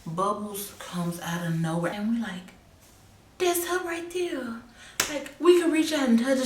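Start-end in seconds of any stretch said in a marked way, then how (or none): 3.4-6.5 excited surprise. Retold or imitated. Sudden sighting of lost friend.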